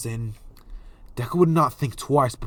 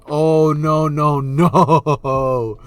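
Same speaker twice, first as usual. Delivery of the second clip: mock dismay; 7 dB louder, much slower than usual. Mock reaction to attempted push.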